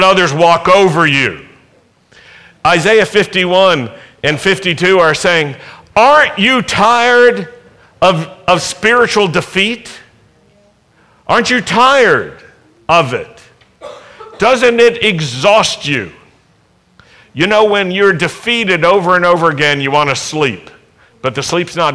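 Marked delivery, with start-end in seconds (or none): 5.9-16.1 impassioned plea. Acted. Scripture voiced pleading with hearers.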